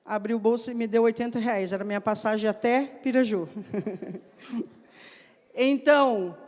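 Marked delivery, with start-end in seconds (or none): 3.5-4.2 low, quiet laugh; brief amused chuckle, kept low; soft chuckle. Real, not acted. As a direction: chuckle softly to yourself after the story. Chuckles after telling the story.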